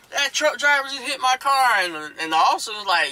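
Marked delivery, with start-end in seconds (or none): 0.1-1.8 high-pitched mock outrage; raised, protesting voice of the wronged driver; retold indignant complaint. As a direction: mimic an upset car owner reporting to police. Bentley owner complains to the officer.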